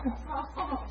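soft, high tearful moan; imitated crying. Minister moved to tears by her plea, high soft cry. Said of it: "already crying".

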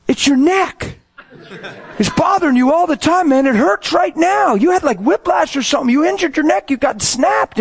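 high, projected retold yelling; yelling across highway. Praying man to driver two lanes over, yelling. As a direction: call out urgently to someone lanes away. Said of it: "He's yelling across the highway".

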